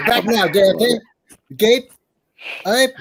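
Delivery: put-on a lightly laughing Southern accent. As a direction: keep the Southern accent going. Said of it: "Southern accent".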